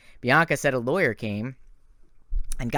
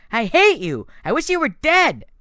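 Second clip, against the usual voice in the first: high, loud furious cry; hateful yell; 14 semitones higher, 7 dB louder than usual. A narrator's reading. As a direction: wound him as hard as he can. Nico yelling at Percy.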